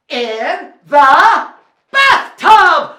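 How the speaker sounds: slow, loud, drawn-out announcement; big reveal. Acted. Storyteller revealing where king dances, loud drawn-out cry. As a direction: build to a booming punchline.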